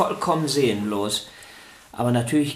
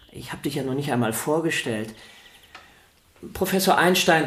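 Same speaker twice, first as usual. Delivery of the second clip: ordinary delivery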